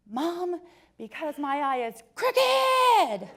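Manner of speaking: put-on high, slow, indignant child's complaint; a mother quoting her upset little boy, slow and indignant; pissed-off kid. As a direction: imitate her young son huffing about his crooked eye. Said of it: "Oh, he was pissed".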